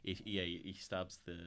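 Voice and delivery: ordinary talk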